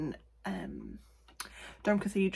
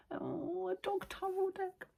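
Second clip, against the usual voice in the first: high, soft squee; cooing delight; 12 semitones higher, 6 dB quieter, slower than usual. Real, not acted. Showing off a dog tarot deck, high cooing delight. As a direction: coo it high and small, as if at a puppy. Gushing over cute dog cards.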